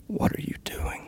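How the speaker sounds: whispered, creepy question; spooky whisper. Pretend. Asking the listener in a spooky whisper. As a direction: breathe it like a ghost.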